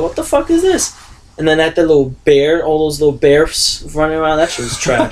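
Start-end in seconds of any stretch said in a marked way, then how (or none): 0.0-1.0 what the hell is this, shrieked high; incredulous outburst. Retold or imitated. Film fan recalling his shock at Chucky, incredulous high shriek. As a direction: pitch jumps way up in disbelief. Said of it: "And I was like".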